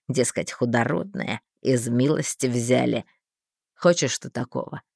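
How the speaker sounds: low, scheming man; sly man voice. A narrator's reading. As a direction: lecture her slyly in a low voice. Thin man persuading the heroine.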